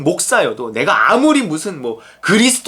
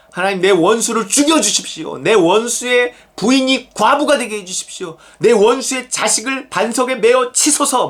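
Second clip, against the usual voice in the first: fervent imprecation in a raised pitch; acted cursing prayer; 6 semitones higher than usual. Preacher voices the psalmist cursing his enemy, fervent. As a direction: cry out a vengeful plea to God.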